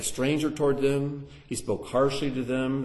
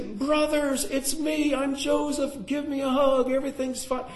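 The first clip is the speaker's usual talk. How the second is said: much higher, bright and eager, playing an overjoyed brother; Joseph's cheery reveal. Preacher imagines Joseph greeting his brothers, high and gushing. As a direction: pitch it way up like a happy reunion. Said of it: "I mean, he'd say".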